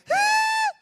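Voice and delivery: loud, very high squeal; comic battle squeal on the charge; mock rage shriek. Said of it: "I'm just angry".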